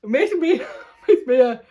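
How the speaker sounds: high, loud, giggly words; real laugh breaking into the reading; laughing while reading. Real, not acted. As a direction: try to read on through giggles.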